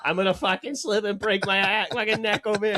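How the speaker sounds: imitating Boston rant. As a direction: play the furious Somerville guy slipping on unshoveled snow. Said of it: "saying all this crazy ass shit".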